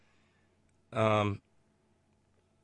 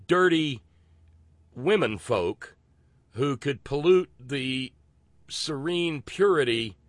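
slow, drawn-out sarcastic emphasis; mocking sarcasm; 4 semitones higher, 4 dB louder than usual. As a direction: lean hard and slowly on each mocking phrase. Sarcastic mock disgust at sexism.

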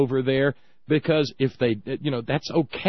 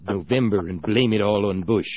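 low, sly villain muttering; scheming old tycoon, rubbing his fingers together; Mister Burns impression; 6 semitones lower than usual. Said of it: "like Mister Burns on, on The Simpsons".

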